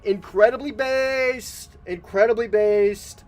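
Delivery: high, loud, slow hype; excited. Real, not acted. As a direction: stretch it out in delight. Hyping a viewer.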